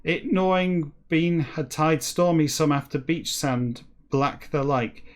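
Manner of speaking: slow, deliberate read-aloud; story narration. Acted. Reader reciting a backwards whale novel to listeners, measured narration. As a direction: read each phrase out with measured emphasis.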